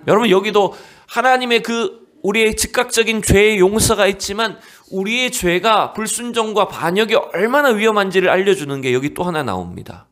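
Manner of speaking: normal talking voice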